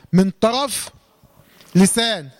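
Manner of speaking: usual speaking voice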